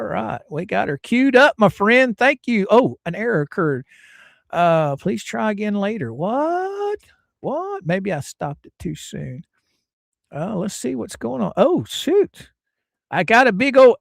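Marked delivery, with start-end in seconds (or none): 6.1-7.8 high, startled double take; surprised. Real, not acted. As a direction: caught off guard by the error. Stream shows an error.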